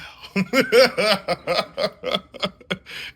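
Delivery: high, loud real laughter. Real, not acted. After the punchline, loud real laugh. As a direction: laugh at your own punchline.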